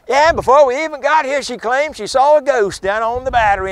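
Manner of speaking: loud, high, scoffing announcement to the room; mocking boyfriend. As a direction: show her up in front of everyone, loudly. Boyfriend mocking her to guests.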